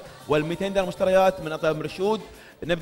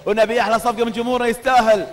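booming prize announcer; 3 semitones higher, 4 dB louder than usual. Real, not acted. Announcing second raffle winner.

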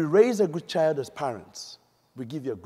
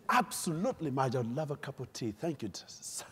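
polite British acceptance; 3 semitones lower, 11 dB quieter than usual. Acted.

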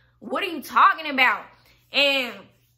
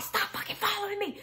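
retold raving; 6 semitones higher, 6 dB quieter, faster than usual. Man walking off from her car, mock frantic. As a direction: imitate him losing it, pitched up without volume. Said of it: "he's just going insane, crazy".

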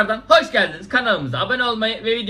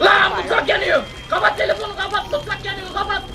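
high frantic yelling; panic, the kitchen's burning; panicked shout; 11 semitones higher than usual. Real, not acted.

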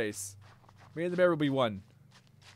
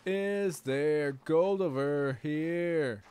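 drawn-out slow; much slower than usual. Pretend.

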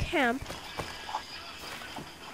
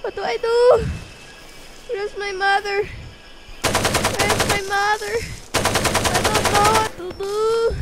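hammed-up fear; 5 dB louder than usual. Player beset by flying geese, in mock panic. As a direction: play helpless panic, wailing loudly. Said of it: "This is so scary!".

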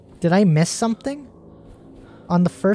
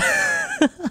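squeaky panicked giggle; high nervous laugh. Real, not acted. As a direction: laugh high from relief and fear. After escaping monster.

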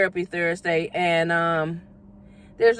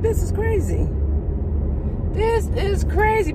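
amazed; 12 semitones higher than usual. Real, not acted. Amazed at leaving the house.

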